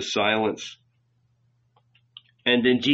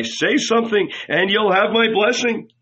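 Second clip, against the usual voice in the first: high-pitched coaxing, insistent; urgent plea; 10 semitones higher, 3 dB louder than usual. A narrator's reading. Old rabbi offering his blessing, pleading. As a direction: beg for news, pitched up.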